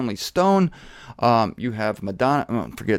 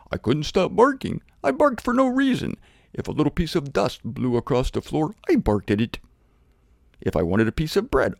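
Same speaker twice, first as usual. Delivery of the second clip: talking dog. Acted. Shot dog forgiving its killer, hammed-up talking-dog voice. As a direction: play a dog owning up to its barking. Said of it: "It's gonna say".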